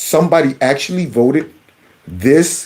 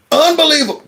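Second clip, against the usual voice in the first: incredulous scoff; 10 semitones higher, faster than usual. Real, not acted. Scoffing at the congressman.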